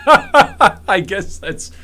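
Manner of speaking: slow, stretched words through a laugh. Real, not acted. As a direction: laugh your way through I guess that's. Laughs through the repeated phrase.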